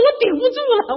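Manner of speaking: high, loud comic protest; loud plea. Retold or imitated. Telling her friend she can't hold on, loud comic protest. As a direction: cry out that you can't hold on. Retold whining phone call to friend.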